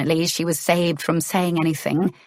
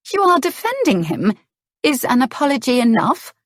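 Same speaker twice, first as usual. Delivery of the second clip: upset Clarissa; 7 semitones higher, 4 dB louder than usual. A narrator's reading.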